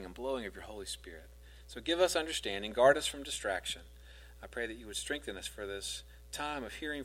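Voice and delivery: soft, low prayer voice. Real, not acted. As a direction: pray quietly before the reading.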